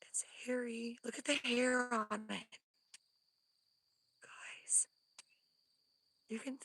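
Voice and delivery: tense, voiceless whisper. Real, not acted. Watching the hairy creature, scared whisper. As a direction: keep it to a breath so it stays still.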